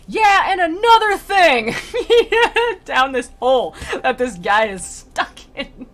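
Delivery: cracking up while trying to say it; giggling through the lines; laughing. Real, not acted. Speaker cracks up mid-quote.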